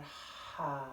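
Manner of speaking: long open "ha" exhale; audible sigh. Shown as a demonstration. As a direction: sigh the breath out on a voiced "ha". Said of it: "Sigh it out".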